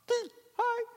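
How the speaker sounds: squeaky, very high hi; a nervous little greeting; sheepish hi. Acted. Boy caught by the guard ant, squeaky and sheepish.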